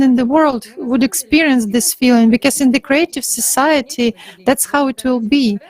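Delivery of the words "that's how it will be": ordinary talk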